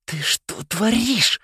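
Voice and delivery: high, slow incredulous hiss; hissing through teeth. A narrator's reading. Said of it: "прошипел Нолан сквозь зубы". (шипеть сквозь зубы)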